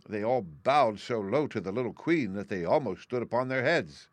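usual speaking voice

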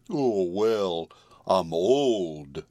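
drawn-out, sinking horse voice; despondent. A narrator's reading. Old horse admitting his age to the girl, despondent. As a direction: let it sag, long resigned vowels. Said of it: "hanging his head despondently".

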